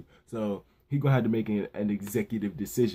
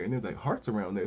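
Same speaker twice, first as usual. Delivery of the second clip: normal talking voice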